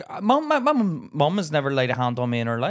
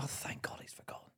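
relieved unvoiced whisper; 22 dB quieter than usual. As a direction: breathe it out in relief. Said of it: "I'd be like".

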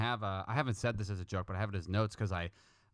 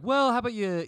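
argumentative; 12 semitones higher, 6 dB louder than usual. Retold or imitated. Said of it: "just argumentative".

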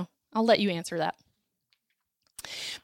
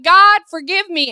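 higher, louder mock plea to God; imitated plea; 5 semitones higher, 7 dB louder than usual. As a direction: cry out as the judged people begging mercy. Israel voiced pleading when judged.

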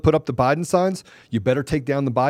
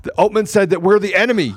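mildly raised, mocking complaint; mock outrage; 5 semitones higher, 5 dB louder than usual. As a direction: imitate indignant objectors, a little higher. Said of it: "They're gonna be like".

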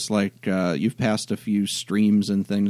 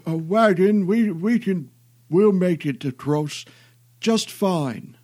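put-on high, slow old man voice; fretful elderly man, quavering; 11 semitones higher, 5 dB louder, slower than usual.

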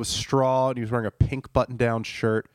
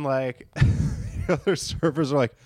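ordinary talk

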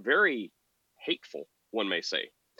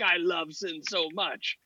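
higher, slow mocking put-on voice; mock sinner; 7 semitones higher, slower than usual. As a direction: savor it as a parody. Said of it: "but, like".

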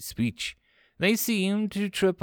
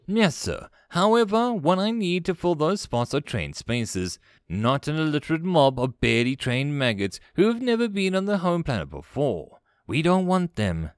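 higher-pitched, insistent protest; objecting first officer; 5 semitones higher than usual. Acted. First officer refuses untrained slaves, insistent. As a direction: argue back firmly to a superior.

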